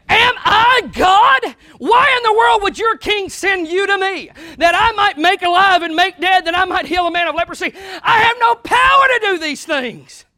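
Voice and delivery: loud, high outraged shout; ticked off king. Acted. Angry king of Israel refusing to heal Naaman, loud outraged shout. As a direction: roar it in disbelief. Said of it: "the king got really ticked off about it".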